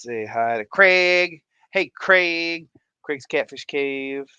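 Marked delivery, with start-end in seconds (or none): none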